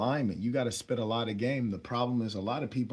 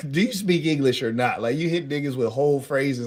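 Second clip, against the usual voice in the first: loud, higher, laughing disbelief; incredulous challenge; 4 semitones higher, 7 dB louder than usual. Retold or imitated. Man challenging woman on her sudden English, retold incredulously. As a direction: call her out loudly in mock bafflement.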